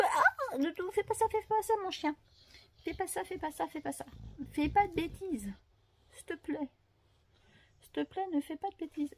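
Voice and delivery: pleading with the dog. Real, not acted. Scolding her big dog softly while he disrupts the video, pleading. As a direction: gently beg the dog to stop.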